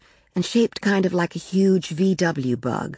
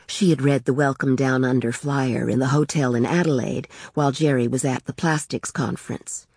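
ordinary delivery, nothing marked

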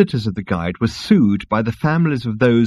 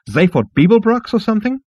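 much higher, sarcastic insect; 13 semitones higher, 5 dB louder than usual. Acted. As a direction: scoff in a high insect voice. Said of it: "flounced the insect, quivering its wings in rage".

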